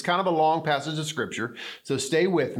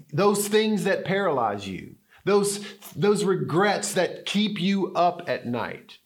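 normal talking voice

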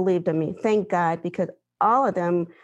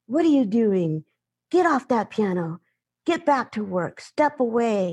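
imitating a faculty member yelling, a bit higher; mock angry order to get off the piano; retold yelling; 4 semitones higher than usual. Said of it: "he starts yelling at me".

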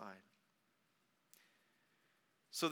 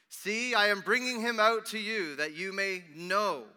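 loud, raised voice easing off; public announcement; 6 semitones higher, 10 dB louder than usual. A narrator's reading. Pilate presenting the prisoner outside, loud announcement. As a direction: proclaim it to a crowd.

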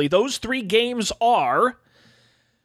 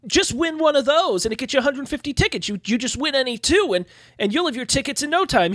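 lightly voiced arcade worker, higher-pitched; Owen; 6 semitones higher than usual. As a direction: pitch it up a little, persuading a customer. Plays the arcade attendant Owen.

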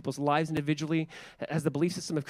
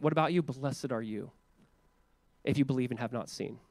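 softer, gentle reassurance; tender Jesus; 6 semitones lower, 6 dB quieter than usual. Retold or imitated. Preacher voices Jesus softly answering the doubter, tender. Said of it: "Jesus is like".

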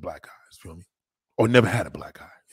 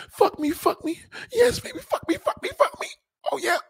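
very high hammed-up moaning; mock moaning. Shown as a demonstration. Woman begging during sex, hammed-up high moaning. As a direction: moan it like a porn scene. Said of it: "Just two words".

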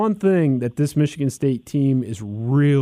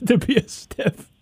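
mocking echo; 10 semitones higher than usual. Mocking repeat of stiff.

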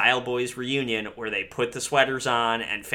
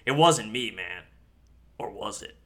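low, quiet, evasive denial; Hunter the Hungry; 4 semitones lower, 4 dB quieter than usual. Retold or imitated. Accused prankster denying he did it, low and quiet. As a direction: deny it softly and low, then leave doubt. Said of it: "and Hunter the Hungry's like".

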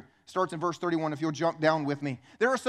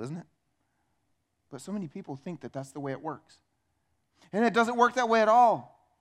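usual speaking voice

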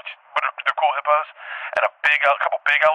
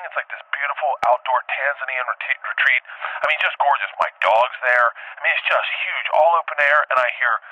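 ordinary delivery

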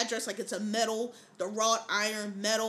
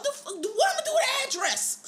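very high, incredulous retold outburst; mock outraged dad; 13 semitones higher, 3 dB louder than usual. Storyteller as dad confronting the address seller, mock outraged. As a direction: act out dad's indignation at high pitch. Acting out angry dad, amused.

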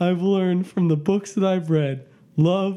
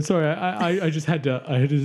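ordinary talk

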